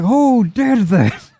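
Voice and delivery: high, drawn-out indignant cry; hammed-up exasperated demand; mock outrage. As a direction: wail it to the heavens, slowly. Game master voices outraged character.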